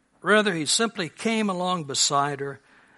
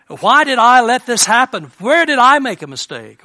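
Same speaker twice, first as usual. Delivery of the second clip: anguished self-blame; 6 semitones higher, 10 dB louder than usual. Retold or imitated. Voicing self-blame after tragedy.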